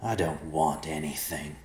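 slow, gravelly threat; menacing gravelly stranger. Acted. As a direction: growl it slowly from the darkness. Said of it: "the gravelly voice said".

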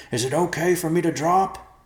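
loud, high shouted question; high-pitched call from above. Acted. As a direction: holler it down the hole. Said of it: "Riverpaw called".

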